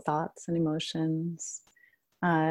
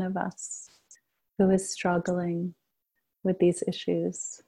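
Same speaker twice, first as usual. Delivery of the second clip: guided meditation. Acted. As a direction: gently guide the group into shared stillness. Leads a group meditation.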